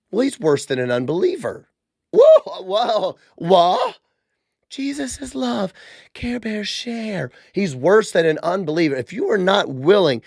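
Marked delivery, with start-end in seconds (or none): 2.1-4.0 mock shock. Host mocks sugary believers hearing harsh Timothy verse, shocked squeal. 4.7-7.3 soft, slow, sugary parody; mock sugary voice. Host parodies Care Bear Christianity, soft and sugary.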